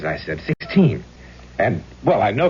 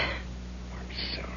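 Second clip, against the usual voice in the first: whisper; 15 dB quieter, much slower than usual. Acted. Ghost whispers apology.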